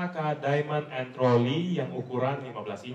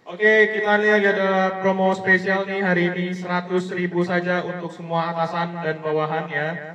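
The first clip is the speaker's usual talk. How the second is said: supermarket announcer; 4 semitones higher than usual. Pretend. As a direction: call out the sale to shoppers. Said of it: "kayak berasa di pasar swalayan". (pengumuman promo di pasar swalayan dengan suara lantang)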